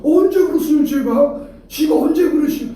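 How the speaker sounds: very high, loud dialect protest; mimicked Chungcheong denial. Preacher mimics no-show denying the promise, high indignant Chungcheong denial. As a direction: deny it indignantly, hammed-up Chungcheong style. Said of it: "전혀 다른 얘기를 합니다".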